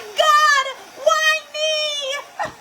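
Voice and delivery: high, hammed-up wail of "why me"; mock self-pity to the ceiling; dramatic lament. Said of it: "I was being so dramatic".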